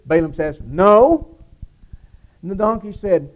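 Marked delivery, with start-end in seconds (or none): 0.6-1.3 curt angry no. Acted. Furious Balaam answering his talking donkey, loud curt no. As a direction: bark a loud, blunt no.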